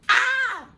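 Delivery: very high, loud "ah" of excitement; excited squeal. Real, not acted. Baker about to bite the cookie, excited squeal. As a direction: let out a short delighted squeal.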